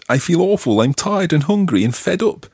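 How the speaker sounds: imitating a bad English accent, pitched high. Teacher replays Andrew's reply from the dialogue, bad English accent. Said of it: "in a rather bad English accent".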